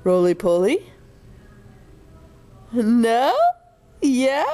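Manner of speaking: normal talking voice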